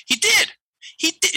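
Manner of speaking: suddenly see it, voice leaping up; dawning realization. Retold or imitated. Realization dawning in retold story.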